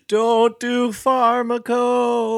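long, high drawn-out wail; slow mock wail of a burnt-out drifter; distant crazed warning. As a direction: call it slowly from down the river. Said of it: "gets a little bit louder now".

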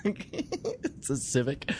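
slow, low deadpan; slow, low dry joke; deadpan pun. Acted.

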